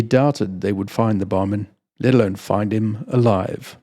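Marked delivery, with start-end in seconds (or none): none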